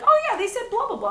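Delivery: breezy mock of husband repeating far-off talk; imitating Malin. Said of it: "he'll be like".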